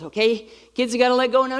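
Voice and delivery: put-on high, climbing plea to clinging kids; pleading dad. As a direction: act a dad trying to get free.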